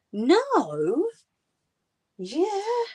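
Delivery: squealing high, imitating the little lady's thrill; excited daughter. Mum imitating her daughter's excitement over the sequel, high squeal. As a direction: mimic a kid who can't believe it. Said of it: "The little lady got proper excited".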